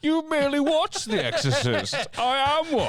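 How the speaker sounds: very high, hammed-up villain voice; laughing mock-villain taunt; Bane. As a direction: boast like a theatrical film villain. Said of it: "Has Bane got a job".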